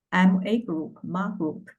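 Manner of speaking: ordinary delivery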